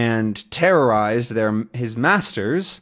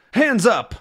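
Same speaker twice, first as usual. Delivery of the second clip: mock command; 10 semitones higher than usual. Thug ambushes Tintin.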